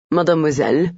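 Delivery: lightly French, gallant question; French-accented courteous query; a hint of a French gallant. Frenchman speaks.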